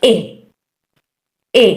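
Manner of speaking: "ए" as a brief "eh"; isolated, cut-short "eh" on "ए"; short E sound. Shown as a demonstration. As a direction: say "ए" like the start of elephant. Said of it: "ई का short sound है".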